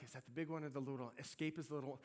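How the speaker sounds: fast, hushed little question; quick aside. Retold or imitated. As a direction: rush it out under your breath. Asking rental clerk about car.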